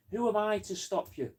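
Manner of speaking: high-pitched show of helplessness; mock deferential reply. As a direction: repeat it high, playing the powerless innocent. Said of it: "You're always going".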